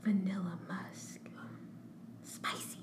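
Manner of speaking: breathy whisper, barely audible. Real, not acted. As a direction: drop to a hushed whisper. Reading options in a whisper.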